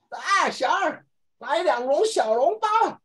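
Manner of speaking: high, slow call to the waiter; old inn customer. Pretend. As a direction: hail the inn boy like a period drama. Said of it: "我们在拍戏吗？水浒传吗？".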